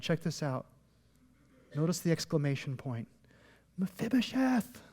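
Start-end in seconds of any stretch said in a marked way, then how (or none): 3.8-4.8 higher-pitched, held-back name call; mock yell. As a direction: call the name out high without full volume. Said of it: "I think he was yelling".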